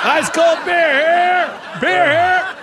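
loud, high, slow vendor call; stadium vendor hawking. Pretend. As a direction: bellow it like a beer vendor in the stands.

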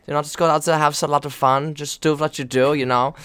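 put-on coach's lively German accent. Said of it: "that's such a good accent".

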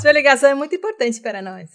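phone hold message. Pretend.

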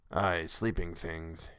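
low, slow, soft murmur; muttering. A narrator's reading. Tussman brooding aloud over sleeping things, muttering. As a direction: mutter it slowly, half to yourself. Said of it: "he muttered".

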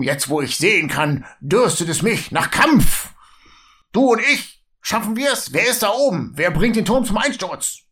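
höher, zunehmend eifrig und drängend; plötzlich kampfeslustig, will nach oben; kampflustiger Zwerg. Acted. Gero, von SL gesprochen. (angedeuteter Zwerg Gero, höher und begierig)

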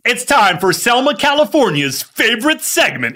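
hyped, drawn-out segment intro; announcer voice. Acted.

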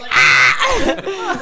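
high, loud gotcha cry; triumphant shout. Retold or imitated. Catching his dad out on the Yeti, shouting. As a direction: shout like you caught him out.

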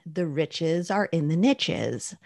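"niches" said "nitches", to rhyme with "riches"; slow saying, with a short i and tch in "niches"; niches rhyming with riches. Shown as a demonstration. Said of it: "important to say niches that way".

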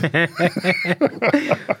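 short high nervous laugh. Real, not acted. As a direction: laugh it off, a bit uneasy. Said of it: "that's his nervous laugh".

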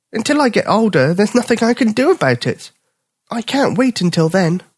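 high, eager cub voice; a cub who wants to grow up now; impatient young bear. Acted. Bipper telling the dog he wants to grow up, high eager voice.

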